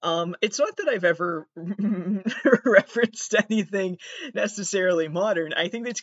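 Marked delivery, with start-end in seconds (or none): 1.5-2.6 short real laugh mid-sentence; quick amused chuckle; light laugh. Real, not acted. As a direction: laugh at herself. Laughs mid-sentence about references.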